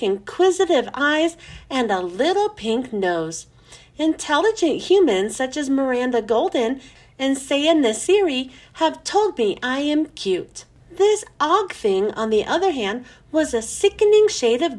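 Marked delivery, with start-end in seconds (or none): none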